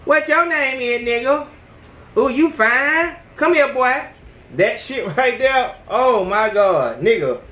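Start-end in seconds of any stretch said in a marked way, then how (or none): 0.1-4.0 put-on high, loud, brash girl calling out; hollering at a guy across the mall; mock loud girl voice; loud belligerent girl. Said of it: "extremely loud, belligerent, using a lot of cuss words".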